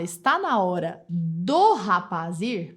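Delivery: "do" drawn out slowly to show the error; stress on do. Shown as a demonstration. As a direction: stretch out "do" very slowly. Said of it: "Você estrangulou seu sujeito". ("do" lento e destacado)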